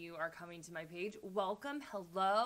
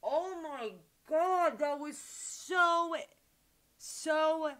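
high, loud, drawn-out raving; blown away; 9 semitones higher, 8 dB louder, much slower than usual. Real, not acted. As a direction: stretch the words out, totally amazed. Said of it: "completely blown away right now".

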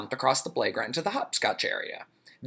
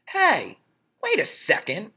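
put-on 1 indignant kid; 10 semitones higher, 3 dB louder than usual. Ron protests.

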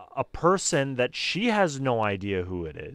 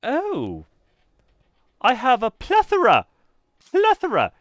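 high, loud British shopkeeper; theatrical British accent, pitched way up; attempted British accent; 12 semitones higher, 9 dB louder than usual. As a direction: gush in a put-on British accent. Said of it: "a British accent".

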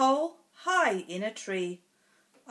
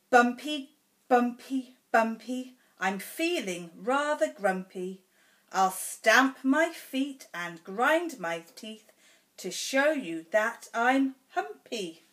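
bold stomp; 2 semitones higher than usual. Acted. Reads picture book to child.

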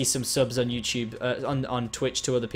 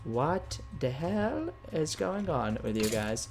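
slow, softer incredulous question; drawn-out bafflement; 7 dB quieter, much slower than usual. Real, not acted. Player baffled by creeper swarm, drawn-out bafflement. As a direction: stretch each word out in disbelief.

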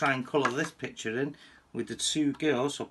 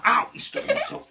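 pained yelp; 6 dB louder than usual. Real, not acted. Sudden pain from a stubbed toe.

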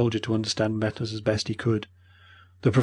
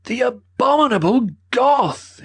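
high and loud, slowed, appalled; cried out in outrage; 7 dB louder, slower than usual. A narrator's reading. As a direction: hurl it out in horror at the vandal. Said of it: "he cried".

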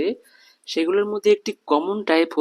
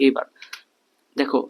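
ordinary delivery

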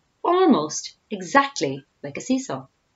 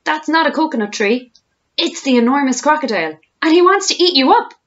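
high, loud urgent alarm; panicked warning cry; shouted warning; 8 semitones higher, 6 dB louder than usual. A narrator's reading. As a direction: shout the danger at full pitch. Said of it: "shouted Humpy Rumpy".

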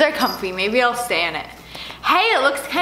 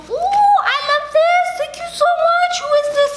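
delighted squeal. Real, not acted. Trying on a fan's hoodie, squealing with delight.

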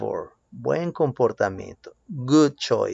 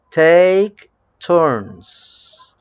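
slow model phrase; 3 semitones higher, 6 dB louder than usual. Shown as a demonstration. Teacher modelling a phrase for pupils to repeat, slow and loud. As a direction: TAKE TURNS slow and loud, each word drawn out.

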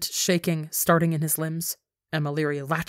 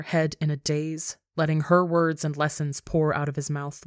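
usual speaking voice

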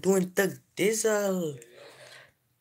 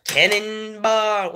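cannonball yell; 5 semitones higher, 6 dB louder, slower than usual. Acted. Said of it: "jump on my bed like this".

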